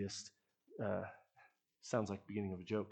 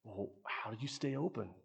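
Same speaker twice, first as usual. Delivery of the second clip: mildly puzzled imitation of a kid, rising "Really?"; surprised kid; 3 semitones higher than usual. Kid answering the youth pastor about the snack shop, surprised. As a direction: play a kid's light disbelief. Kids' puzzled reply, acted out.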